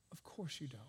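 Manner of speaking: breathy hushed aside, voice dropped to nothing; whisper. Real, not acted. Whispering gently to doubting listeners. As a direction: lean in and whisper it to the congregation. Pastor answers his own question.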